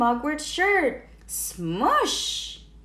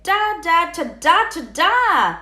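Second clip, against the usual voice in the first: bugle call; 5 semitones higher, 6 dB louder than usual. A narrator's reading. Imitating a bugle blast.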